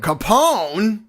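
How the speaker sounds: shouted. A narrator's reading. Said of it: "he shouted".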